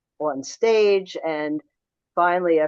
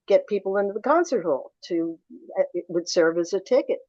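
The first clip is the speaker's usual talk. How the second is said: usual speaking voice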